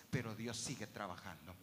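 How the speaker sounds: usual speaking voice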